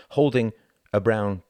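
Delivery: usual speaking voice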